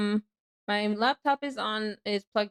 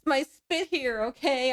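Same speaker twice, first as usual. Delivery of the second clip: ordinary delivery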